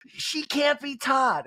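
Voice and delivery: high, drawn-out impression; Kyle. Housewife giving verdict on her friend, high slow impression. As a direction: stretch it out high, mimicking her.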